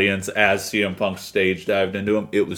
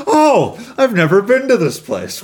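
high, loud gushing retelling; hammed-up squeal of delight; mock delight; 10 semitones higher, 5 dB louder than usual. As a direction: imitate someone thrilled by a gift, voice way up. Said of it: "And he's like".

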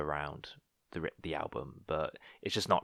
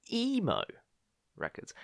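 mock squeal. Teasing co-host's emo taste.

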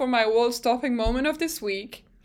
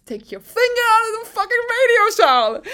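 high, loud, fast mock outburst; frantic scolding; 11 semitones higher, 7 dB louder than usual. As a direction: blow up at the driver in exasperation. Exaggerating the engineer's plea to driver.

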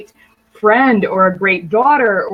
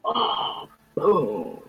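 whispered boom; 9 semitones lower, 8 dB quieter than usual. Real, not acted. Reacting to the tip.

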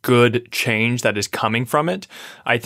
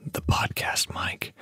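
whispering; 9 dB quieter, slower than usual. Pretend. Playful whisper about the mic.

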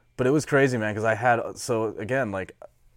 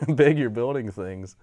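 ordinary delivery

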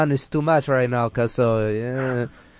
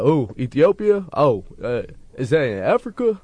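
high, puzzled imitation of the uninformed; mock clueless questioner; 4 semitones higher than usual. Guest imitates Houston people confused by his origins, mock cluelessness. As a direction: lightly mimic someone who has no idea. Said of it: "they'll be like".

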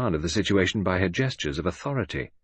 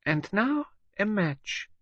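much higher, slow, whispered menace at the end; menacing villainess; 13 semitones higher, slower than usual. A narrator's reading. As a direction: gloat over a trapped enemy.

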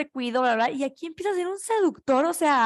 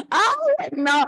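high, loud fangirl squeal; swooning shriek; excited squeal; 7 semitones higher, 5 dB louder than usual. Real, not acted. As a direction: squeal with delight at the romance. Fangirl squeal over the book.

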